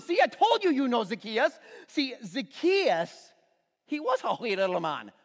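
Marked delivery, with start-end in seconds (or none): 1.9-5.1 a hint of Scottish accent on wee little man. Shown as a demonstration. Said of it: "I always have to say it with an accent".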